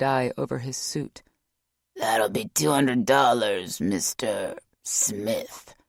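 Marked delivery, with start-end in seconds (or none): 1.9-5.8 slow rasp fading to a whisper; raspy. A narrator's reading. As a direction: rasp it out around a cigar. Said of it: "the guy rasped".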